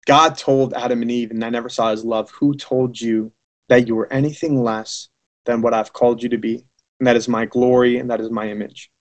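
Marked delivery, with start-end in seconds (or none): 2.3-8.9 tender voice of God. Acted. God speaking, slow and low.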